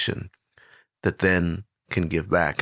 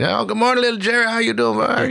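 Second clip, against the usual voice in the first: put-on much higher, friendly voice of an old street neighbor; Mister Joe's greeting; 13 semitones higher, faster than usual. Homeless neighbor greeting the speaker as a boy, much higher and cheerful. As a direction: sing it out like a cheerful older man greeting a kid. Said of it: "you know, and".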